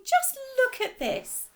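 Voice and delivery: high, loud and drawn out slowly; excited shout. Acted. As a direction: call out, bursting with joy. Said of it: "she was shouting".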